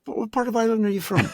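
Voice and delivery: put-on high, quick Irish-accented question; Irish director. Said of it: "they had an Irish director".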